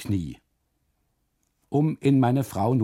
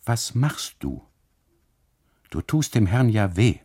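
mild scolding; 6 dB louder than usual. Acted. Husband scolding wife.

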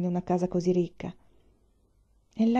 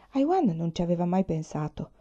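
ordinary delivery, nothing marked

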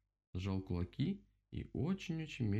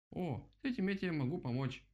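higher-pitched happy eagerness; delighted; 7 semitones higher than usual. A narrator's reading. Rabbit glad he can help the owl, delighted. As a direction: brighten up and offer gladly, pitched up. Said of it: "обрадовался кролик".

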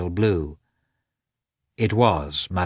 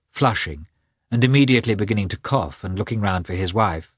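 usual speaking voice

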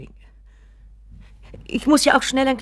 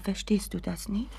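pleading whisper; 9 dB quieter than usual. Acted. Mother pleads with son.

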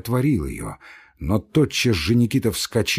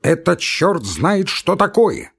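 higher, louder outburst of indignation; outraged cry; 7 semitones higher, 4 dB louder, slower than usual. Acted. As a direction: burst out in outrage. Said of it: "вскрикнул вдруг Иван Дмитрич".

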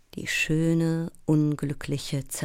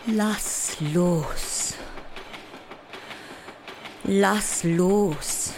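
desperate command; 2 semitones higher, much slower than usual. Acted. Sleepless actress begs her obsession to let go, desperate. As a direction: plead to be released, stretching both words. Pleading with her racing thoughts.

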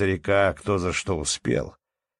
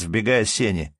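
plain reading voice

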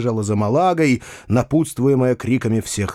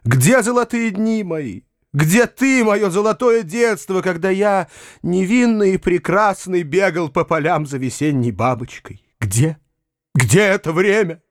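delirious lament; 8 semitones higher than usual. A narrator's reading. Foma begs everyone for lost innocence, raving. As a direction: build from wistful declaiming to wailing demand. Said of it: "как будто был в жару и в бреду".

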